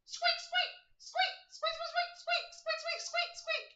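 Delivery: very high squeaky animal squeaks; squeaking critter. Acted. GM voices Boris squeaking at Pietro, tiny and high.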